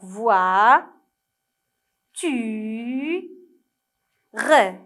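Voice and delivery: "voix, tu, re" drawn out with long pauses between; isolated syllables. Shown as a demonstration. Teacher spelling out the word to write, "voix, tu, re" separately stretched. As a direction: dictate "voix, tu, re" one slow syllable at a time. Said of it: "la première syllabe qu'on doit écrire".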